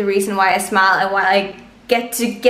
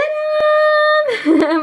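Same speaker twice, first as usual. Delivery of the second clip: squealing laugh of pure joy, much higher; high delighted laugh; 3 dB louder than usual. Real, not acted. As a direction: burst into a giddy high laugh.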